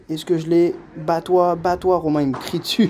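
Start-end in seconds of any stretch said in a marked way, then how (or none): none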